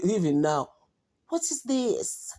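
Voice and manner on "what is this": higher, slow, put-on kid question; imitating kids. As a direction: drag it out like a puzzled child. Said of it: "This is how they speak".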